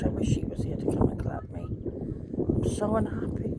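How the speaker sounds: whispered, breaking into sobs; real tearful breakdown. Real, not acted. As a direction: near tears, barely voicing the words. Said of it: "I don't want to cry on here".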